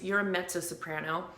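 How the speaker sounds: normal talking voice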